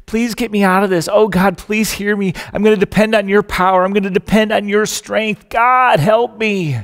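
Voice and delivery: loud, frantic pleading to God, pitch climbing; desperate prayer. Acted. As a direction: beg God for rescue while dangling. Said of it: "And he starts praying".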